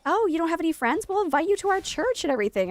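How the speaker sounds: imitated church mothers. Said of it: "everybody and their mother was like".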